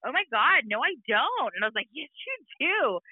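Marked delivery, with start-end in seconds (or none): 0.1-1.5 put-on higher, swooping Valley girl protest. Daughter denying her accent to mom, mock Valley girl. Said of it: "You speak with a Valley girl accent". 1.9-3.0 high, drawn-out teasing comeback; teasing insistence. Retold or imitated. Mom teasing her daughter back, drawn-out insistence.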